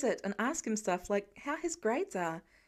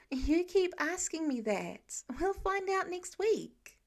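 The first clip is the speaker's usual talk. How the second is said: slow, higher, exasperated; groaning Peter; 6 semitones higher, slower than usual. A narrator's reading. Peter answering Tony's question again, slow and exasperated. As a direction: whine it out, fed up. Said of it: "Peter groans".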